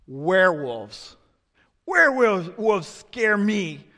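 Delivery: slow, drawn-out mock child answer; child's fearful confession. Schoolboy telling what scares him most, slow and dramatic. Voicing a child naming his fear.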